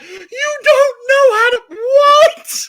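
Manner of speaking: high, very loud disbelieving shout; retold shriek of disbelief; incredulous outburst. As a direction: yell it as if you can't believe it.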